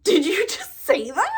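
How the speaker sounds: high, loud gasping disbelief; shocked. Retold or imitated. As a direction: react like you can't believe it. Shocked at crystal speaking.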